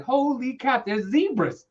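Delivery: high-pitched, amazed outburst. Retold or imitated. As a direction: replay the shock of seeing zebras. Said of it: "I was like".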